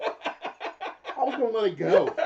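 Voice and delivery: high, loud words through laughter; can barely get it out for laughing. Real, not acted. Teasing his cohost about a slip, laughing hard.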